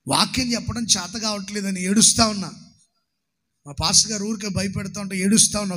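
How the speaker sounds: ordinary talk, nothing marked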